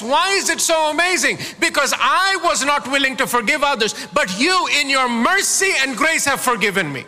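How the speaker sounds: ordinary delivery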